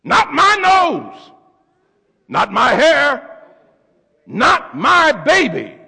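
slow, high, indignant denial; an outraged father refusing the child; Hosea disowning. Acted. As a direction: drag out each denial in disbelief. Hosea voiced disowning the baby.

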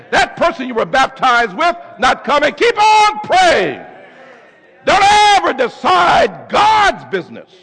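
rising, shouted preaching; fiery preacher builds to a shout; preaching at full force. Real, not acted. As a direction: call it out to the congregation.

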